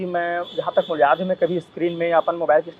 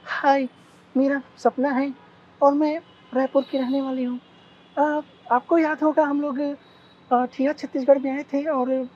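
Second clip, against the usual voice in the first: put-on high girly voice on a prank call; man doing a woman's voice; 8 semitones higher than usual. Shown as a demonstration. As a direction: play a sweet young woman on the phone. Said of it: "ऐसे अपन mobile पकड़ के ना".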